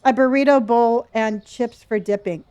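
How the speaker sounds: normal talking voice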